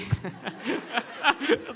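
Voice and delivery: high, amused laugh. Real, not acted. Laughing at the dialect speakers, high laugh. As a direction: burst of laughter at own joke.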